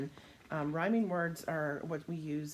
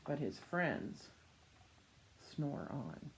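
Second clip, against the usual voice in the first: gentle read-aloud fading to a hush; slow down and settle into sleep; reading to children; slower than usual. A narrator's reading. Reading picture book to children.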